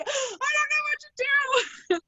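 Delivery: very high, drawn-out mock-panic squeal; panicked. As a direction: squeal helplessly, hamming up the alarm. Acting panic as water streams at her.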